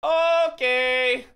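mock impression of a friend saying okay; mimicking him. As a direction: echo his okay in a mock voice, rising. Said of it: "He says".

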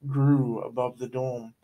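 usual speaking voice

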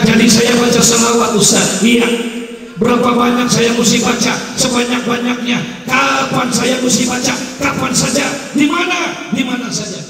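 high, loud, quick exchange; eager back-and-forth. Acted. Young man eagerly asking the preacher how to pray, high and loud. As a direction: fire questions and answers eagerly. Rapid eager question and answer.

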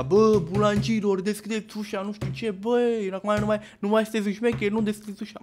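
high-pitched demanding landlord voice; imitated angry landlord. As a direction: mimic someone demanding to be let in. Landlord demands entry through the door.

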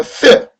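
쓰 loud with a sharp fall; fourth-tone demo. Shown as a demonstration. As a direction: drop 쓰 steeply in Mandarin fourth tone. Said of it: "근데 사 성이에요".

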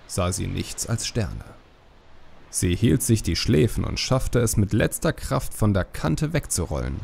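usual speaking voice

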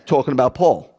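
ordinary talk, nothing marked

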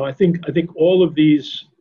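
usual speaking voice

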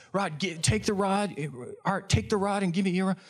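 high, hurried, stumbling urging; frantic instructions. Retold or imitated. Guide swaps rods with Art, frantic and high. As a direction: re-enact flustered orders in a scramble. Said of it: "in the franticness of it all".

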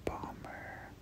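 ordinary delivery

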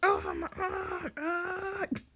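high, very drawn-out moan; hammed-up cry of horror; mock anguished wail. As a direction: wail it slowly, high-pitched. Acted horror at the deed.

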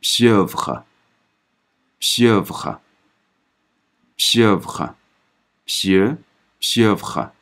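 pieuvre stretched extremely slowly; slow French demo. Shown as a demonstration. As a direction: sound out pieuvre bit by bit. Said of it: "开音节呃的音". (极慢示范读pieuvre)

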